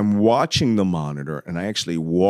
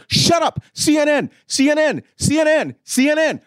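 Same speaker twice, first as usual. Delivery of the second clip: high, insistent yell; high-pitched urgent phone shouting; retold shouting; 4 dB louder than usual. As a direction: shout over him, repeating it urgently. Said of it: "all I could do was shout".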